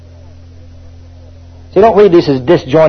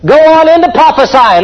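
fiery exhortation; 9 semitones higher than usual. Acted. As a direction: urge them onward. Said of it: "Don't just stop".

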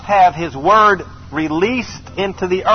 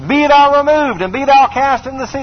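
preaching at full force; 7 semitones higher than usual. Acted. Preacher commanding the mountain before the congregation.

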